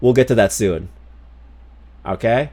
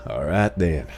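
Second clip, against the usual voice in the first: Southern drawl; 6 semitones lower, much slower than usual. Pretend. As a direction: stretch it out like a gunslinger at noon. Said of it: "Southern accent time".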